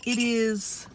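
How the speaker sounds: ordinary delivery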